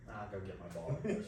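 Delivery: low, quiet, held-back retort; restrained reply. Retold or imitated. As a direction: say it small, keeping the irritation in.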